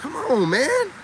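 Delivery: ordinary talk, nothing marked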